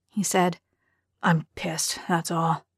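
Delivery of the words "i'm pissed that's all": low, muted admission of anger; subdued. Acted. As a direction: say it quietly, holding it in. Darren admits quiet anger.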